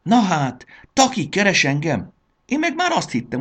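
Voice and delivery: high, loud burst of delight; overjoyed. A narrator's reading. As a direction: exclaim it in joyful astonishment. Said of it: "örömében megpörgette lapjait".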